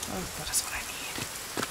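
breathy whisper to herself; hushed aside while playing; whispered realization. Real, not acted. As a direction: whisper it like a sudden idea. Muttering to herself in game.

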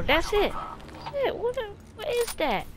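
high, excited disbelief; rising amazement at the kill. Real, not acted. Reacts to the game.

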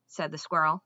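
ordinary delivery, nothing marked

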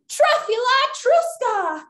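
high, slow, drawn-out magic words; magic incantation. Pretend. Leader casting a spell with children, grand incantation. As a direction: proclaim the spell grandly, stretching it out. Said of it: "on the count of three".

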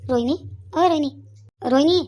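loud call across the house. Acted. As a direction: call her name from another room. Calling out to Rohini.

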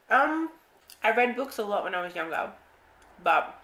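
speaking with food in mouth; mid-bite muffled speech; chewing while talking. Real, not acted. Seen 3: Spoon enters mouth at start, then chewing movements and bulging cheeks while speaking.

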